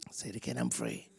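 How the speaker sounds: soft whispered prompt. Shown as a demonstration. Preacher leading the woman to whisper I'm free. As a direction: coax her gently, very softly. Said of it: "Can you whisper this?".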